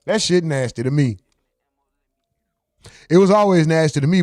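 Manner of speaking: normal talking voice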